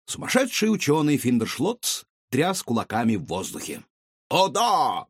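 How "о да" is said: shrill, excited mad scientist; yelling with manic glee. A narrator's reading. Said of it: "вопил он".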